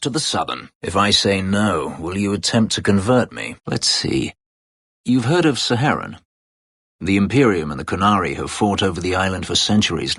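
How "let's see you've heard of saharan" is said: ordinary talk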